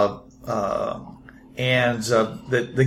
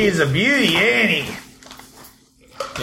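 higher, admiring show-off in character; proud tinkerer; 5 semitones higher, much slower than usual. Acted. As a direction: boast playfully about your pride and joy. Moby shows off his bike.

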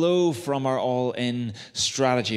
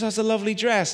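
gushing compliment; 9 semitones higher, faster than usual. Retold or imitated. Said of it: "where someone says".

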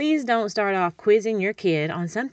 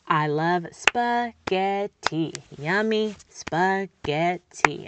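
spaghetti drawn out slowly, beat by beat; slow syllable modeling; much slower than usual. Shown as a demonstration. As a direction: savour each syllable slowly for a toddler. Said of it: "And clap it out".

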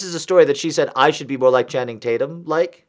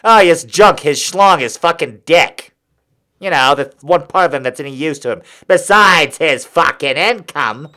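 lightly voiced 1950s Brooklyn wife griping; a hint of a Brooklyn housewife complaining about her husband; 3 semitones lower than usual. Comic mocking women's words in a light 1950s Brooklyn wife accent. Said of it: "stereotypical wives from nineteen fifties Brooklyn".

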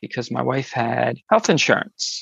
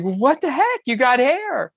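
higher-pitched mock outburst at ordinary volume; loud astonished friend; 10 semitones higher than usual. Boisterous friend reacting to the new hair, astonished. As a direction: imitate a boisterous friend's amazement. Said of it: "my friend is more loud and such".

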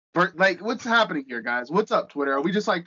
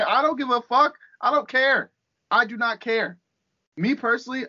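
high, insistent protest; emphatic exasperation; 5 semitones higher than usual. Real, not acted. Ranting host denies caring about a wrestler, emphatic exasperation. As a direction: protest too much, pitch raised. Insisting he does not care.

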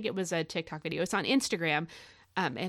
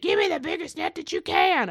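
put-on a little girl reeling in a fish calls loudly for the net; imitating a straining little girl; 9 semitones higher, 6 dB louder than usual. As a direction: strain it out like a kid fighting a big fish. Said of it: "just the way she said".

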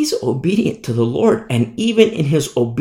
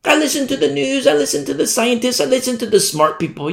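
higher, prissy self-satisfied voice; smug know-it-all; 9 semitones higher than usual. Acted. A smug crowd member lecturing Amos, higher and prissy. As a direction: boast like you know better.

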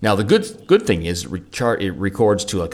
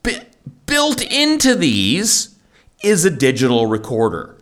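infomercial pitch; 5 semitones higher, 4 dB louder than usual. Pretend. Infomercial-style reveal.

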